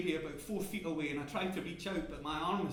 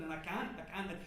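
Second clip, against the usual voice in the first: normal talking voice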